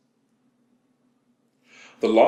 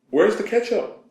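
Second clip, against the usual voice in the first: high, louder call to another room; yelling across the house; 12 semitones higher, 4 dB louder than usual. Retold or imitated. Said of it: "I yell out to my girlfriend".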